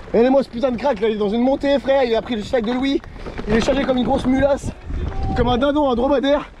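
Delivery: high, amazed praise; excited hype. Real, not acted. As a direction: hype up your buddy. Hyping up friend on the climb.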